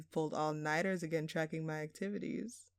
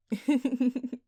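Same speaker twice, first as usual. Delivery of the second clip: amused laugh; 7 semitones higher, 9 dB louder than usual. Acted. Villain teasing the detective.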